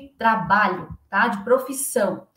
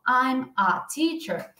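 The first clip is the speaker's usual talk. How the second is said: I'm a teacher slow, I'm and a kept apart; slow separated version; much slower than usual. Shown as a demonstration. Teacher contrasting the careful way with the natural one, slow. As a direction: drag out I'm a teacher word by word. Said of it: "ao invés de eu falar".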